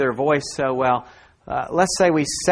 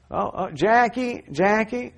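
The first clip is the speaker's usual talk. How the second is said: higher, drawn-out call for someone; grandmother calling out; 6 semitones higher, slower than usual. Retold or imitated. Preacher imitates grandmother calling his mom over the monitor, calling out.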